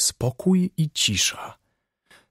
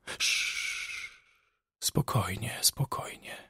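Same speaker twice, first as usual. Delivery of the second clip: soft shush into a bare whisper; secretive hushed reassurance; hushing whisper; 13 dB quieter, slower than usual. Acted. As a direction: whisper it cautiously, calming and very quiet. Patient hushes listener in fear.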